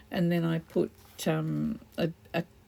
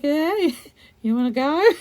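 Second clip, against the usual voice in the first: a hint of a cocky tough guy, louder and higher; tough-guy cat; 7 semitones higher, 7 dB louder than usual. Cat blocking the dog at the door, cocky challenge. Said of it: "Pippa's like".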